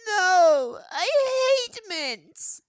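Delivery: put-on crying child. A narrator's reading. Screaming boy refuses the mint.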